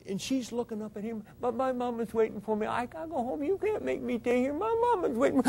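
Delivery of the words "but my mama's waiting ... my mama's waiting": put-on high, quiet little girl pleading; little girl's voice. Joni answering Freddie, quiet little girl's voice. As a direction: plead softly like a small child. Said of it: "little girl's voice".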